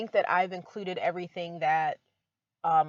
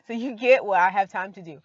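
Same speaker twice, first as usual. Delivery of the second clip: laughing; 3 semitones higher, 8 dB louder than usual. Real, not acted. Joking that she's only one person.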